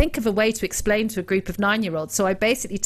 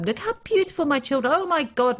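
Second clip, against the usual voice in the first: higher-pitched parody of boastful parents; mock gushing; 8 semitones higher than usual. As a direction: imitate gushing social media mums, pitch up. Mimics gushing social media parents.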